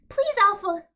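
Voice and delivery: high, loud whine; whining plea. Acted. Pleading with his alpha, a loud high whine. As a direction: beg like a needy pet. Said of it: "I whine".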